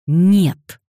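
loud angry bark. Acted. Daniel barking a refusal at the little girl, loud. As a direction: snap it shut at her. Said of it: "рявкнул Дэниел".

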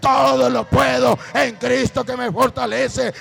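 preaching at full force. Acted. Preacher proclaims Bible verses to the congregation, shouting.

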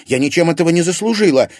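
high, loud, fast indignant cry; flaring up. A narrator's reading. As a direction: snap back in hurt anger. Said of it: "вспылила Лиза".

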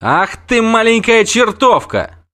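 high, loud, slow scolding yell; the wounded knight shouts at the girl who nearly killed him; angry shout. Acted. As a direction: roar it at the little troublemaker. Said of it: "крикнул он".

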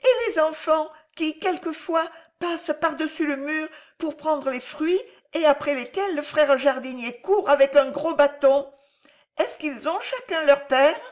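put-on high-pitched child, insistently curious; questioning little boy. A narrator's reading. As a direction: play a small boy piling up questions. (voix aiguë de petit garçon curieux)

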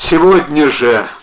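loud, slow, insistent shout; calling out. A narrator's reading. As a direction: shout it with effort. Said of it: "крикнул он".

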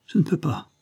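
high, helpless protest; helpless plea. A narrator's reading. Child failing the balancing trick, helpless plea. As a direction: give up timidly.

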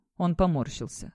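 ordinary delivery

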